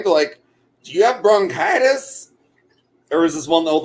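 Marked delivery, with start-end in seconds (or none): 0.8-2.3 put-on high-pitched country-accent question; mock country accent, pitched up. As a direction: imitate nosy country folk asking, in their accent. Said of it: "they say in the country accent".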